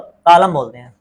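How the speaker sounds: ordinary talk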